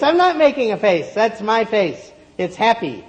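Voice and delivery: put-on high, loud, cheerful girl voice; a bright girl insisting on her face; girl's high voice. A narrator's reading. Girl protests with happy face.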